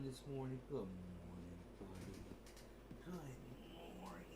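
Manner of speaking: soft, tender talk to the dog; soft cooing. Real, not acted. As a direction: coo gently at the pet. Cooing softly at his dog.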